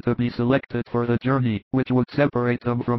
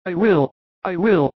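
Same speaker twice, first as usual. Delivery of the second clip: higher-pitched, keen offer; eager volunteers; 6 semitones higher, 4 dB louder than usual. A narrator's reading. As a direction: jump in as several eager volunteers would. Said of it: "they all want to go".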